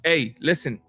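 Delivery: usual speaking voice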